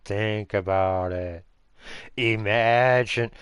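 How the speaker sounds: slow, drawn-out sarcasm; sarcastic. Real, not acted. Telling Jacob to think about it, slow sarcasm. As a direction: spell it out sarcastically, very slowly. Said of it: "Yes, I'm being sarcastic".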